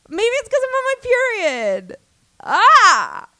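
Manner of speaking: gasp, then high, loud epiphany; real excited gasp of realization; sudden realization. Real, not acted. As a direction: blurt it out as it dawns on you. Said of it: "what the fuck was I saying".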